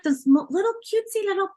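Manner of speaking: high, cooing cute voice; cutesy gush. Real, not acted. Describing teak bowls in a high cooing gush. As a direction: coo over something adorable.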